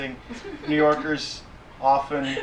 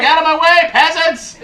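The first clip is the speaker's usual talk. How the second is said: haughty, high, loud shout; hammed-up imperious yell; big umbrella people; 11 dB louder than usual. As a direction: bellow it like a lord clearing commoners. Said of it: "They're like".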